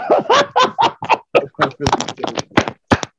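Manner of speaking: long helpless laugh; real laughter. Real, not acted. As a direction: crack up laughing. Cracking up at the story.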